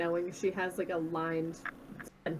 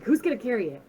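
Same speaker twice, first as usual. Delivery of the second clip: excited; 5 dB louder, faster than usual. Acted. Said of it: "I'm looking at you excitedly, like".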